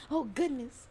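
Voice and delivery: ordinary delivery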